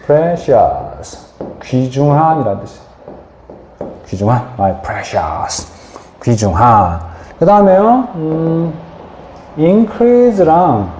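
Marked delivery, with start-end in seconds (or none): 4.6-5.7 low, drawn-out creepy whisper of Gollum. Retold or imitated. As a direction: croon it like Gollum clutching the ring. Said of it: "골룸이 뭐라 그래요".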